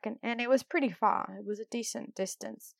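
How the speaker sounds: ordinary delivery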